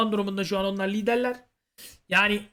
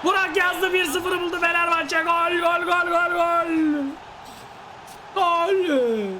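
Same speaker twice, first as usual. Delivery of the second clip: screaming football commentator; 7 semitones higher, slower than usual. Acted. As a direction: scream the goal call at full voice. Goal scored in football game commentary.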